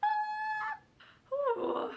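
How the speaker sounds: very high, loud squeal, then a sigh; real high-pitched cry after laughing. Real, not acted. Breaks up laughing during impression.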